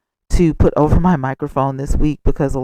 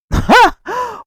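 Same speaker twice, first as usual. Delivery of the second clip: high laugh; 4 dB louder than usual. Real, not acted.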